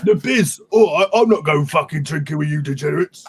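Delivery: hammed-up tough-guy impression; Bisley impression. Friend mimics an artist refusing drinks, hammed-up impression. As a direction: put on a mock tough-guy comic artist. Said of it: "It's a good impression of my impression".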